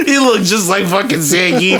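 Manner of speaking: words squeezed out through laughter; can barely talk for laughing. Real, not acted. Cracking up at own roast.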